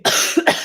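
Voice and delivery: quick throat cough. Real, not acted. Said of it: "excuse me".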